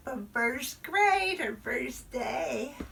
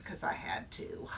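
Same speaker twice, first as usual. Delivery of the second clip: whispered aside; 9 dB quieter than usual. Acted. Grudging admission after obeying.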